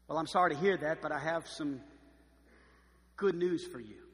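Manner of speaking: normal talking voice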